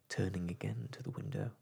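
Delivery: usual speaking voice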